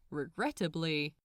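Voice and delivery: ordinary delivery, nothing marked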